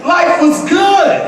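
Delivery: exultant shout of good times; joyful shout. Real, not acted. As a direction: shout it out like life is sweet. Shouting joy over the dinner.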